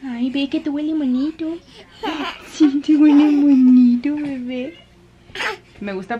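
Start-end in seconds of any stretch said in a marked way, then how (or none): 0.1-4.8 tender coo. Real, not acted. Mother cooing that her baby smells nice. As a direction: coo lovingly.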